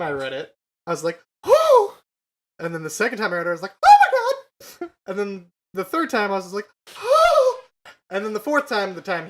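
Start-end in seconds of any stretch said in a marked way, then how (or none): 1.5-1.9 high, loud excited whoop; shrill delighted fangirl cheer; fangirl squeal. Retold or imitated. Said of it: "Would you like an enactment?". 3.8-4.9 high, loud, slow drawn-out gasp of joy; overjoyed fangirl shriek; fangirl squeal. Retold or imitated. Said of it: "Would you like an enactment?". 7.0-7.9 high, loud excited whoop; shrill delighted fangirl cheer; fangirl squeal. Retold or imitated. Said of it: "Would you like an enactment?".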